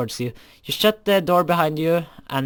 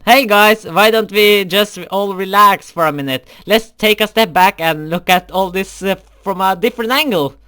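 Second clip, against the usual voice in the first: nervous peacemaking; 4 semitones higher, 7 dB louder than usual. Acted. Intervening between jealous girls.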